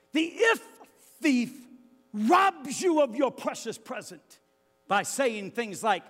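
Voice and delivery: high and loud proclaiming; preacher naming the thief of if; fiery preaching. Real, not acted. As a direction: hammer the warning home. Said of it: "preaching at full force".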